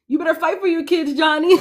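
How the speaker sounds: high cheer breaking into laughter; laughing cheer. Real, not acted. Fan cheering on Johnny for his kids, laughing cheer. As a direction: call it out high, tipping into a laugh.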